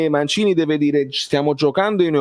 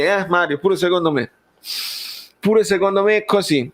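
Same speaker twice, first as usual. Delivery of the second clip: normal talking voice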